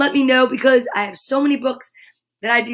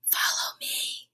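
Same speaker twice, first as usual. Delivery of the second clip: soft slow whisper, much quieter; 12 dB quieter than usual. Acted. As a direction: breathe it slowly to the cats. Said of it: "Kip whispered".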